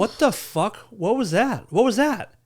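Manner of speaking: high-pitched startled questions, rising in panic; acted alarm. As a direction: play a swimmer suddenly startled in the water. Acts out shark victim's first bite.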